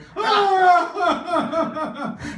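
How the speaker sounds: loud, high, hammed-up sobs; mock bawling. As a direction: bawl in long heaving bursts. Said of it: "I start bawling".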